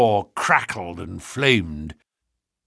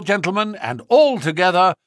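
plain reading voice

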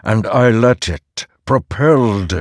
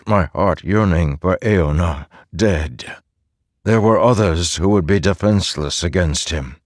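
ordinary delivery, nothing marked

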